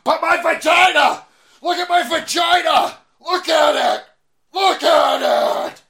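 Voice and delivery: high screaming whiny woman; shrill woman shout. Pretend. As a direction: shriek it, demanding attention.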